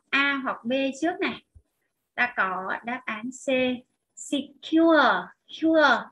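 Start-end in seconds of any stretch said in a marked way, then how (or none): 4.1-6.0 "secure" with a strong, louder "cure"; stress on second syllable. Shown as a demonstration. Teacher showing where the accent falls, "secure" stressed on "cure". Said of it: "nó nhấn dấu sắc ở đằng sau".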